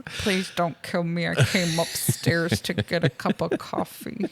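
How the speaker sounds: British-German attempt at an American accent; bad American accent. Fake hostage pleading with John, badly faked American accent. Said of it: "British guy pretending to be a German guy pretending to be".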